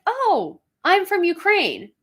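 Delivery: high, delighted "Oh, I'm from Ukraine"; light up at the shared homeland; high, bright, excited recognition; excited reply. Retold or imitated.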